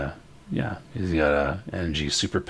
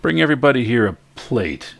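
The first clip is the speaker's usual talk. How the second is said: loud, higher-pitched call across the room; yelling out; 7 semitones higher, 7 dB louder than usual. Acted. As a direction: holler an order to the kitchen. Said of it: "he yells out".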